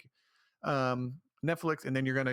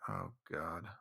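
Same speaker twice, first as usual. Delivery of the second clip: exasperated groan; 8 semitones lower, 10 dB quieter than usual. Retold or imitated. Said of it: "I'm like".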